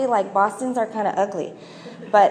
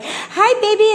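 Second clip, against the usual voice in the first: much higher, louder sing-song greeting; cooing at baby; 13 semitones higher, 7 dB louder, slower than usual. Retold or imitated. Albanian men greet the little girl, much higher cooing voice. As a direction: coo it like a stranger greeting a toddler. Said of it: "they're like".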